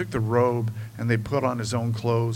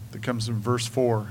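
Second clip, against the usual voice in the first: ordinary talk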